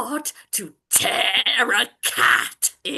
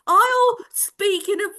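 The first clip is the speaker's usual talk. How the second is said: high voice; 10 semitones higher, 3 dB louder than usual. Acted. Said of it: "monstrous little voice".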